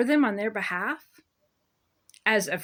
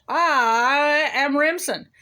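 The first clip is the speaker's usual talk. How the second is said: slow, loud, higher pompous judge voice; mocking the judge; 5 semitones higher, 6 dB louder, slower than usual. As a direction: ham up a smug judge declaring herself. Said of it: "She's like".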